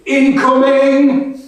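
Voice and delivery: high, loud mock-alarm shout of incoming; shouted call. Soldier retelling the outhouse joke to her listener, loud mock shout. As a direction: bellow it like a battlefield warning, drawn out. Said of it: "we always shouted".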